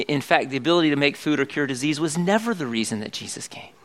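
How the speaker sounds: usual speaking voice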